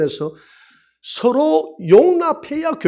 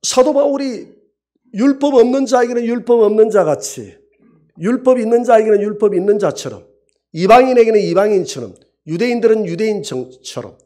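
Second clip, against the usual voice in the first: raised, louder preaching of Paul's words; 5 semitones higher, 4 dB louder than usual. Acted. Preacher proclaiming Paul's words to the congregation, raised. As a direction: proclaim it from the pulpit. Quotes Paul at full preaching force.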